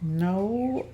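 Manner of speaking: ordinary talk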